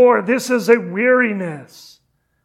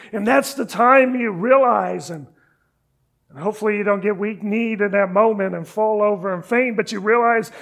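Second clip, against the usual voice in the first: ordinary delivery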